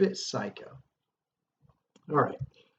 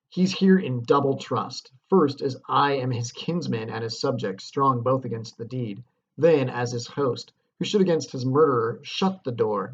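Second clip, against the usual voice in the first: dramatic Shakespeare reading; 4 dB louder than usual. Acted. Teacher reads Macbeth's soliloquy to the class, dramatic declamation. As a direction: declaim the soliloquy, louder and weighty.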